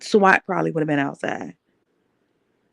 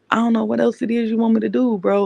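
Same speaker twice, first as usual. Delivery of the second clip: higher, quicker plea, half-panicked; desperate plea; 4 semitones higher than usual. Retold or imitated. Retelling how she pleaded with her abuser, higher and rushed. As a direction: beg him to tell you what he wants.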